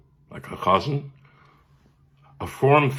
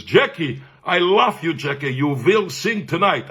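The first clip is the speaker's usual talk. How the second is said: higher, effusive Viennese voice teacher; Viennese accent; 5 semitones higher than usual. Retold or imitated. Teacher greeting his voiceless pupil, effusive Viennese accent. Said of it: "He was my Viennese cantorial teacher".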